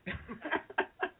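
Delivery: soft, breathy chuckle; quiet relieved laughing; light voiceless laughter. Real, not acted. Laughs after getting the name.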